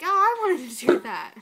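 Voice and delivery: hammed-up letdown; mock whine. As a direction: imitate a disappointed protest, loud and high. Acts out Donnie's funny complaint.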